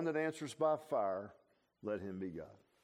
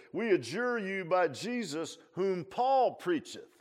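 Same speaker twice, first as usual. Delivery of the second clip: solemn incantation; 5 semitones higher, slower than usual. Retold or imitated. Exorcists' formal words quoted.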